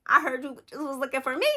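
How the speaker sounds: cocky rapper taunt. Retold or imitated. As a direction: deliver it like a rap punchline. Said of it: "What [name] say?".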